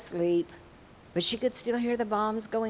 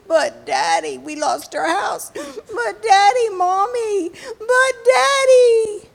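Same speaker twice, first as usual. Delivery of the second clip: high, loud, building wail; crying child; 13 semitones higher, 10 dB louder, slower than usual. Acted. Frightened girl who lost her house, wailing to Daddy. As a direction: sob out a protest, climbing to a drawn-out cry. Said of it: "she started to cry".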